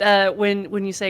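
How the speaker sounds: ordinary talk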